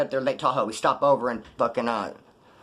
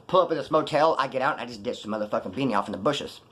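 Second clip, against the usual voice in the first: ordinary talk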